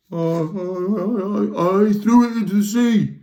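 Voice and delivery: high, very slow stammer into a frightened confession; stammering fear. Acted. The Trawl confessing to angry pirates, scared stammer. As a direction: stammer it out terrified.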